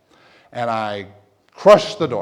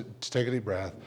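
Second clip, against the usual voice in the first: ordinary talk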